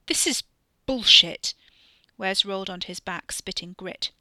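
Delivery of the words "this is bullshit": high, loud angry protest; very loud indignant cry; outraged yell. Acted. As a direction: spit it out in outrage. Wes yells from ground.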